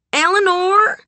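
high, loud call with a rise. A narrator's reading. Sugar summons Eleanor from the doorway, calling out high. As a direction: holler through a doorway. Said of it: "Sugar called through the doorway".

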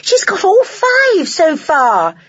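high, stretched-out cheer; excited shout. A narrator's reading. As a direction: shout it slowly up high in amazement. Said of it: "they shouted".

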